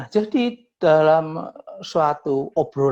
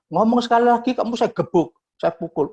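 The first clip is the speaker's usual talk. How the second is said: raised, threatening bully's snap; retold bark; 6 semitones higher, faster than usual. Arrogant friend threatens the speaker at the pool, angry bark. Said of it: "dia bentak".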